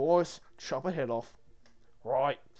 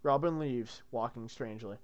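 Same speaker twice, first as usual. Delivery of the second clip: ordinary delivery, nothing marked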